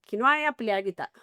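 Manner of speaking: fast, clipped-off mock warning; rapid scolding. Wife warning her husband off fighting at home, a rapid mock scold.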